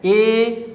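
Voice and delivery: "ए" as a sustained ay; demonstrated vowel. Shown as a demonstration. Teacher showing the front of the tongue working, demonstrated vowel. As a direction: hold "ए" long and level. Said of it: "देखिएगा जीभ का अगला भाग".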